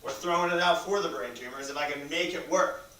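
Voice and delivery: loud, tense and exasperated; pushing through the frustration; frustrated. Real, not acted. Stressed call about charity setup.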